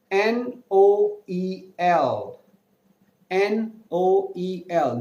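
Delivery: N O E L spelled slowly, letter by letter. Shown as a demonstration. Teacher spells the word slowly for students. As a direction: spell N O E L out, each letter apart. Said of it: "If you have two vowel sound together".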